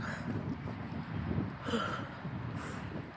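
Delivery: long breathy sigh; heavy weary sigh; tired exhale. Real, not acted. Tired sigh after walk.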